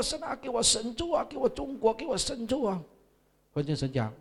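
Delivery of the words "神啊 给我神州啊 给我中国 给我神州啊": crying prayer. Retold or imitated. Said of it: "我就跪在那边哭啊".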